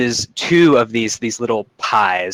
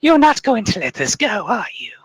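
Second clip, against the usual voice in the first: much higher, sly witch voice; creepy crone; 12 semitones higher than usual. Acted. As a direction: tease them, knowing they won't budge. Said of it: "she just sighs".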